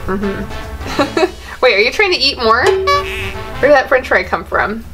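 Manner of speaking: usual speaking voice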